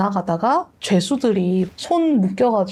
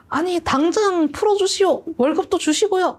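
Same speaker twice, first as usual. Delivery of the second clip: raised-pitch, commanding knight's voice; lordly knight's demand; 9 semitones higher than usual. Retold or imitated. Don Quixote ordering the master to free the boy, high and commanding. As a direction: play the pompous knight giving orders.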